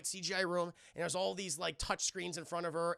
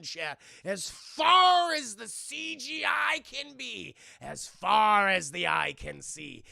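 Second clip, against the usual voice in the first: awed shout; 2 semitones higher, 6 dB louder, slower than usual. Real, not acted. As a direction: stretch it out in awe. Awed description to chat.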